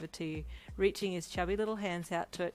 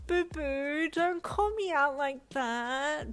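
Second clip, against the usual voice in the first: high, loud, slow theatrical wail; embarrassed friend protesting his partner, high drawn-out wail; wailing Brian; 9 semitones higher, 5 dB louder, much slower than usual. A narrator's reading. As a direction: whine it out, mock-wounded. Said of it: "Brian wailed".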